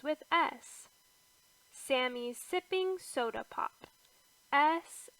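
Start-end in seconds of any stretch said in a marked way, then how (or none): none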